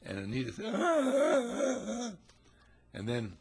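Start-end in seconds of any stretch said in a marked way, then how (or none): 0.7-2.1 high-pitched, loud mock wail of a jilted bride; mock sobbing wail. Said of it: "as Anita was standing there crying".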